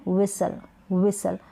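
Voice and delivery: व्हिसल said twice with no T; silent T. Shown as a demonstration. Teacher repeating the correct व्हिसल with silent T. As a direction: say व्हिसल व्हिसल dropping the T. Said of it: "व्हिस्टल नहीं".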